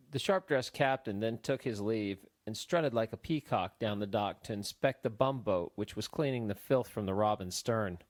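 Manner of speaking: ordinary delivery, nothing marked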